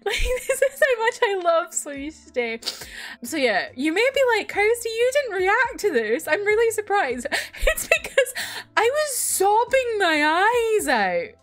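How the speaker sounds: real tears of joy, half laughing; crying while laughing. Real, not acted. Fan overwhelmed by Sonicité's comeback, tearful laughing. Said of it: "I'm crying".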